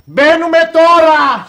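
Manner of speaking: very high, slow, loud cry; drawn-out shout. Acted. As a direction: call it out long and high. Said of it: "Σκάσε επιτέλους". (παρατεταμένη, ψιλή κραυγή)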